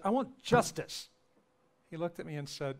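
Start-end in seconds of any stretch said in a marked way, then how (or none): 0.0-1.1 sudden loud high outburst; retold angry cry at the priest; angry demand. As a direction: pound it out, demanding justice. Pounding the podium in confession.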